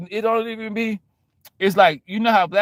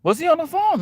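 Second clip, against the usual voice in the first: high, anxious question; nervous onlooker; 12 semitones higher than usual. Retold or imitated. Bystander checks whether he was on the phone, high and worried. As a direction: ask it worried.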